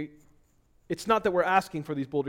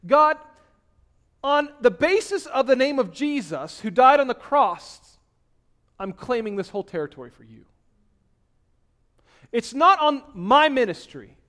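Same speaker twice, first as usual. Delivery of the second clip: loud, high preaching that slows on the key words; fiery preaching; 8 semitones higher, 10 dB louder than usual. Acted. Preacher rousing the church about praying in Jesus' name, loud and high. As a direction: pound the point home to the congregation.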